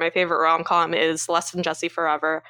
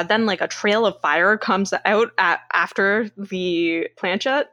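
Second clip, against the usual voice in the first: normal talking voice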